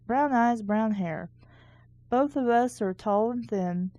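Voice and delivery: ordinary talk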